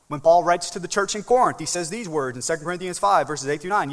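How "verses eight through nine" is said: usual speaking voice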